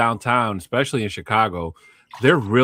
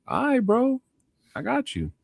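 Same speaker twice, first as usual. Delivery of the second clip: much higher-pitched acted reply; placating reply; 4 dB quieter than usual. Driver answering the security guard, high placating reply. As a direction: go way up high, giving in to the order.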